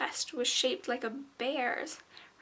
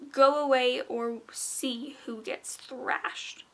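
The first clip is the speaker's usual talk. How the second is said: menacing whisper; 3 dB louder, slower than usual. Acted. Gargoyle puppet threatening.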